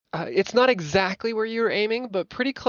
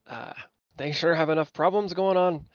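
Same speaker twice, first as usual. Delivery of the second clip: ordinary talk, nothing marked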